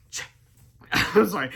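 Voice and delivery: plain reading voice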